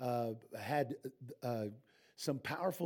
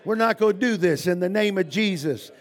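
strong, raised prayer voice; loud prayer; 8 semitones higher, 15 dB louder than usual. Acted. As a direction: declare it to God, strong and loud. Closing prayer, still loud.

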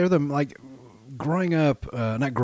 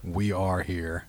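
dramatic; 6 semitones lower, 6 dB quieter, much slower than usual. Pretend. Dramatic echo of cohost.

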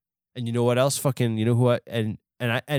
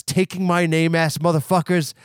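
ordinary talk, nothing marked

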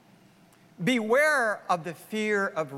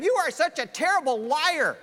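high, rising to a loud shout; angry Pharaoh; 10 semitones higher, 4 dB louder than usual. Acted. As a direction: throw him out in rising fury. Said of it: "says to Abraham".

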